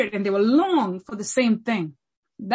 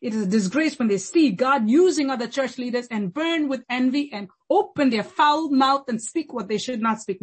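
usual speaking voice

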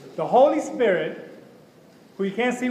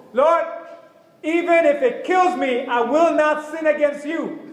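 higher and louder, slow, pleading with everything; earnest loud plea; 6 semitones higher, 4 dB louder, slower than usual. Shown as a demonstration. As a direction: cry it out to God like you mean business. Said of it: "When I say it like that".

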